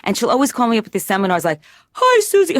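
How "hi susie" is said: put-on much higher, louder nasal-sounding cross of Kermit and Julia Child. Patient imitating cousin Judy phoning her, Kermit-Julia Child voice. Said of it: "cross between Kermit and Julia Child".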